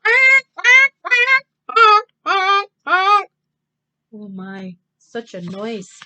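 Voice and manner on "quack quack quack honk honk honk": loud high quacking then honking; quacks and honks. Acted. Ducks and cars make a racket, loud and high. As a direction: blast out the noise.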